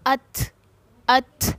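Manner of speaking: अथ stopped on t, then a small puff of breath; hams on ta. Shown as a demonstration. Teacher showing how to read silent ta, breathy release on अथ. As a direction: let a little air escape after the t of अथ. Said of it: "हम इसको किस तरह से पढ़ेंगे".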